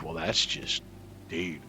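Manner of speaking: normal talking voice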